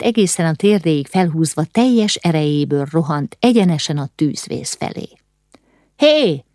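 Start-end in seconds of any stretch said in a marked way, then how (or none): 6.0-6.4 high-pitched shout to stop someone; startling cry, pitched well up; sharp call. A narrator's reading. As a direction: call out "hey" abruptly. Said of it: "kiáltott rá valaki".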